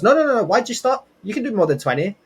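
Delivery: high-pitched, insistent coach imitation; urgent protest. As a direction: burst in high to stop someone quitting. Uncle urging him on, retold.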